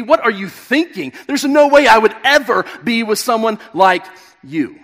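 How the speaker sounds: a proud man shaming her, growing indignant as he goes; arrogant scorn. Retold or imitated. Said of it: "He could have said".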